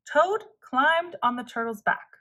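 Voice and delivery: plain reading voice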